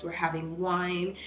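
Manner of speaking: ordinary delivery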